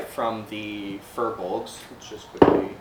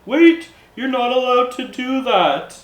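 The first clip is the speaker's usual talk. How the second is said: high, loud shout of protest; yelling Furbolg; 12 semitones higher, 8 dB louder than usual. Acted. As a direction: yell after someone who is getting away. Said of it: "and is yelling".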